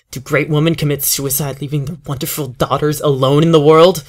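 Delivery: rising loud bitter challenge; bitter anger. Acted. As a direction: throw the question back at them. Grieving father's bitter outburst.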